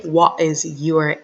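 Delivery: slow clear question. Shown as a demonstration. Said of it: "Can anyone guess".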